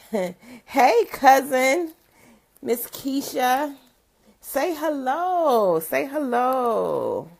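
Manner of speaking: high, loud, drawn-out hello; excited greeting. Real, not acted. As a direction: call out to your cousin happily. Greeting cousin joining the stream.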